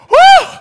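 high loud whoop of laughter. Real, not acted. Preacher whooping at his own punchline. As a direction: let out a wild whoop.

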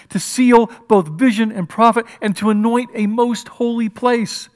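preacher reading scripture, swelling to full voice. Acted. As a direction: read the prophecy with mounting fervour. Reading scripture with rising force.

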